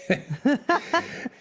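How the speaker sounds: a short, real light laugh that rises. Real, not acted. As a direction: laugh briefly at the thought. Host laughs after the quote.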